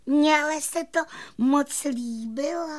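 very high tiny fairy voice; admiring little fairy. A narrator's reading. Fairy applauding the magician's botched trick, high delighted voice. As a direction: coo it in delight. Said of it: "zatleskala malá víla".

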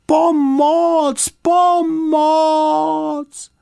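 high, drawn-out cries for help; long loud wails for help; calling out loudly. A narrator's reading. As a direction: shout for rescue at full voice, very high. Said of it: "potom pořádně nahlas".

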